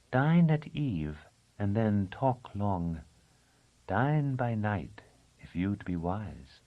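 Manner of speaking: slow, measured recitation of a rhyme; a slow, lilting verse spoken by Thea; recited verse. A narrator's reading. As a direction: chant the old saying slowly like a charm. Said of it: "Then she quoted a scrap of verse".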